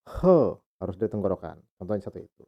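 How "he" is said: "he" pushed from the throat; throaty h. Shown as a demonstration. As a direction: Mandarin h sound. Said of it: "suara lebih nyaring di tenggorokan".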